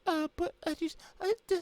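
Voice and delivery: tiny, very high mock stammer; imitation of a panicked girl squeaking; scared. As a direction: stammer helplessly in a squeaky put-on voice. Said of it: "'cause she's scared".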